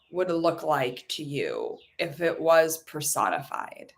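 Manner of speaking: slow, calm guiding voice; guided visualization. Acted. Coach guiding listeners to meet money, slow and calm. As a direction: lead them gently into the image.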